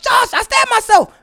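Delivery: high-pitched mock panicked cry; imitated panic. Husband imitating wife bursting in to wake him, high panicked cry. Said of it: "She woke up".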